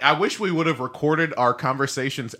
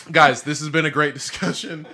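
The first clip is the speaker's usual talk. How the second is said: ordinary delivery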